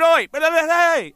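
high, loud yelled call; imitated shouting. Rigger calling the drummer mid-solo, imitated shouting. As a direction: holler to be heard over drums.

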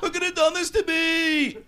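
high, theatrical sitcom villain wail; Newman. Acted. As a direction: lament it high like a wronged schemer. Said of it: "the guy who plays Newman".

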